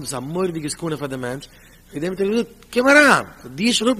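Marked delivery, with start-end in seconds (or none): 2.7-4.0 calling out. Retold or imitated. Calling the man over.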